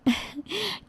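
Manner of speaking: quick pleased giggle, rising; a light happy laugh into the phone; short laugh. Acted. Daughter laughing with her mother on the phone, a quick giggle.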